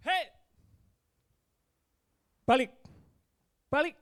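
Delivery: short, high parental yell; acted shout. Father yelling at his child near the gutter, acted shout. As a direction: call the child back sharply, as a shout. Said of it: "saya sudah teriak".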